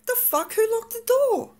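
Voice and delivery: very high-pitched, incredulous outburst; incredulous, high retelling; retold indignant disbelief. Retelling finding herself locked out.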